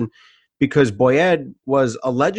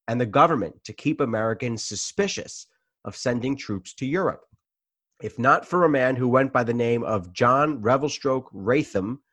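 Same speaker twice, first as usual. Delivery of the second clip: normal talking voice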